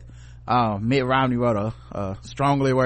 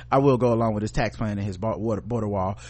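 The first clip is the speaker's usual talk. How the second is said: ordinary delivery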